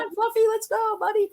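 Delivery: high sing-song call to a reluctant pet; coaxing a dog. Retold or imitated. Owner coaxing Fluffy into the car, high coaxing call. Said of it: "You're like".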